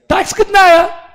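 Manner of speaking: high, loud shout of the question. Real, not acted. Teacher shouting a quiz question to students. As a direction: fire the question at the class.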